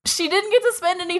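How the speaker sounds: high, disbelieving outburst; incredulous. Retold or imitated. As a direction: squeak it out in disbelief. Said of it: "And I'm like".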